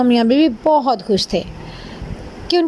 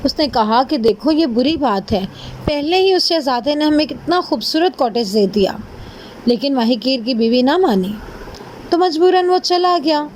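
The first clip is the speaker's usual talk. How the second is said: ordinary delivery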